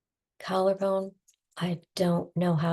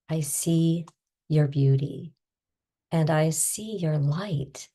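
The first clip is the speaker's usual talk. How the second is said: guided tapping script. Acted. Guiding listeners through tapping, calm soothing tapping voice. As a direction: talk them gently through each tapping point.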